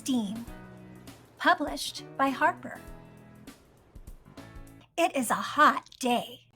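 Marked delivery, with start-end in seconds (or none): none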